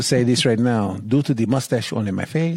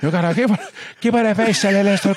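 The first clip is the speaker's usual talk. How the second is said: hammed-up Spanish TV voice with a stiff mouth; stiff-mouthed Spanish accent; 8 semitones higher than usual. Shown as a demonstration. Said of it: "you get the stiff mouth in there".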